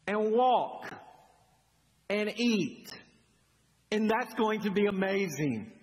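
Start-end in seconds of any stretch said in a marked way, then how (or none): none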